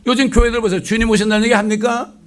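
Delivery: loud, high preaching at full force; fiery preaching. Acted. Preacher rebuking today's churches, loud and high.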